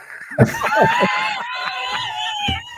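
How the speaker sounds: big high laugh shouted out. Real, not acted. Host bursts out at trade idea.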